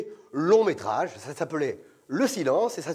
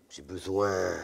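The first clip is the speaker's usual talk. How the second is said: much lower, drawn-out need; casting director voice; 11 semitones lower, 4 dB quieter, slower than usual.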